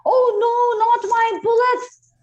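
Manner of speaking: high, loud cry of protest; alarmed cry. A narrator's reading. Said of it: "cried Manpreet Singh".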